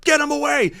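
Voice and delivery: high, mock barked order; imitating cops. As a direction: parody the police. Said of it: "And they're like".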